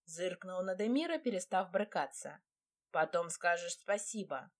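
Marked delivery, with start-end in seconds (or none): none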